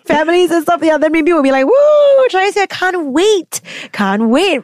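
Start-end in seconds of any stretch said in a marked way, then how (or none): 1.6-4.6 high, hammed-up "can't wait" cheer; mock excitement. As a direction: fake cheer that sours at the end. Mock excited future self.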